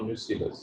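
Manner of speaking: न्यूसिलस said as nyoo-si-lus, short i in the middle; correct pronunciation. Shown as a demonstration. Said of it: "मैं इसका तलफ़ुज़ कर रहा हूँ".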